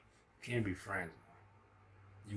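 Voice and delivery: ordinary delivery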